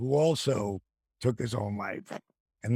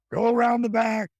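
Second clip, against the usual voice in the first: raised voice calling directions; loud quoted command; retold shout; 7 semitones higher, 4 dB louder than usual. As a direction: call out to send them round back. Gordon Solie shouting directions.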